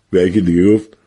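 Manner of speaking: usual speaking voice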